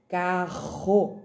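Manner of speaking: "carro" in two slow syllables, strong breathy R. Shown as a demonstration. Teacher showing the double R, "carro" slow with a strong R. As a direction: split "carro" and push the aspirated RR. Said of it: "com dois R's".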